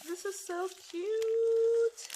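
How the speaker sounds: high, loud, slow drawn-out squeal; squealing with delight over a craft gift; excited squeal. Real, not acted. Unboxing gifts, gushing over item.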